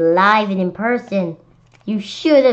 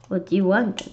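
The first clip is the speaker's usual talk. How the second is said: plain reading voice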